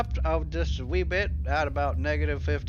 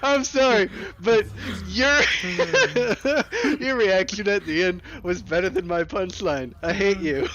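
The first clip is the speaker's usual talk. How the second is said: laughing; 12 semitones higher, 7 dB louder than usual. Real, not acted. Can't stop laughing at reaction.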